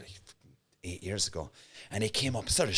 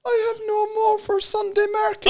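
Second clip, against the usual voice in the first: imitating the candle lady. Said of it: "she goes".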